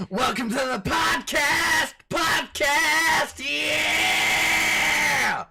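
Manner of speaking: screaming high and loud; shouted intro. Acted. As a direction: blast the intro like a hype host. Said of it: "I'm sorry for your eardrums".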